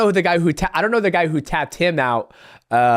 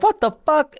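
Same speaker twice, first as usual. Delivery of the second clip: shocked disbelief; 12 semitones higher than usual. Retold or imitated. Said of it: "I was like".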